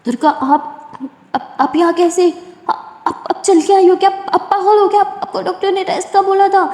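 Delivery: high, loud, stammering alarm; shocked and alarmed. Acted. Simran alarmed to see injured Durga walking, high stammering shock. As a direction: panic at seeing her up on hurt legs.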